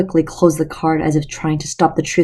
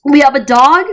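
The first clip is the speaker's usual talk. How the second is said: higher and louder, thrilled disbelief; girl thrilled to learn they have a dog; excited high squeal; 9 semitones higher, 5 dB louder than usual. Acted. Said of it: "high-pitched squeal".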